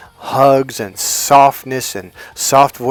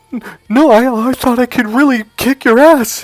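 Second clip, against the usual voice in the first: imitated sobbing; 8 semitones higher than usual. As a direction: mimic her blubbering through the sentence. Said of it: "why are you crying like this?".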